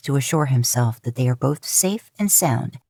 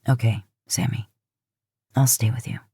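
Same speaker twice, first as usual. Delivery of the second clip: reassuring; 5 semitones lower than usual. Acted. Dean comforting Sam.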